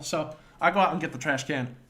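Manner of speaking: ordinary talk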